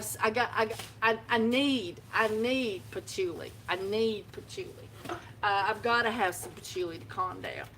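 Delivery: normal talking voice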